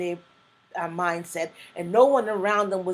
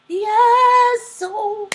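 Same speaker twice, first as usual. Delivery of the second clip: much higher and louder, a jubilant cry; excited yes; 13 semitones higher, 11 dB louder than usual. Real, not acted. Testifying to the viewers, a loud, high-pitched joyful yes. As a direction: burst out with a triumphant yes. Excited about breaking the curse.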